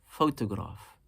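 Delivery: "Photograph" stressed on PHO, falling away; stress on first syllable. Shown as a demonstration. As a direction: lean lightly on the opening syllable of "Photograph". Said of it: "the first one".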